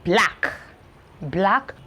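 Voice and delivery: careful teacher model. Shown as a demonstration.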